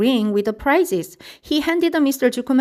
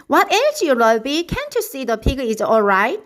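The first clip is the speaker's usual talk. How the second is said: scolding; 4 semitones higher than usual. A narrator's reading. Drenched Zuckerman scolds Lurby.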